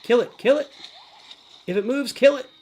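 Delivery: high, loud urging; urgent command. Real, not acted. Player ordering troops to attack, urgent shout. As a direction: call out the attack, pitch jumping up.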